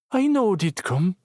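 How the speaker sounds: put-on high, small child's certainty; a tiny girl stating it plainly; little girl voice. Little girl answering Logan, high and sure. Small girl's surprising reply.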